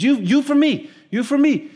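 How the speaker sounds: put-on a small boy insisting, bright and eager; excited little kid. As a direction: kid pitching it high to make the grown-up understand. Kid repeats his invitation.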